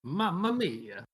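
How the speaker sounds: slow, soft mock Italian; Italian accent. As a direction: sigh it like a stage Italian.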